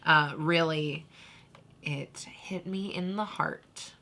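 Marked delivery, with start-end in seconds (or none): 1.8-3.9 slow, breathy whisper of being moved. Real, not acted. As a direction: let it slip out in a soft whisper. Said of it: "moved, whispered".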